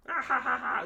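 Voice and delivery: high, cackling laugh; hyena laugh. Retold or imitated. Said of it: "they laugh".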